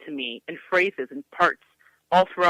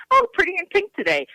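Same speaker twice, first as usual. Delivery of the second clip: high, fast mocking singsong; mocking classmate; 14 semitones higher, 4 dB louder, faster than usual. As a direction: tease her like a snide classmate. Classmates mocking her pink uniform.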